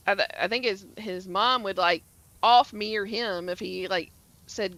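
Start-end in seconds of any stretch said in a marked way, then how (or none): none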